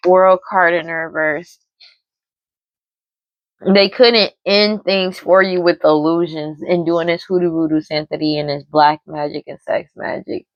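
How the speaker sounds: ordinary talk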